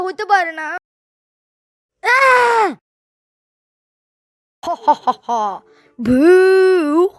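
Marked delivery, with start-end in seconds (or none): 2.0-2.7 sudden high frightened scream. Acted. Scared by ghost in dark. 4.7-5.6 evil laugh. Acted. Ghost laughs in the dark. 6.0-7.1 spooky boo. Acted. Scaring someone in dark.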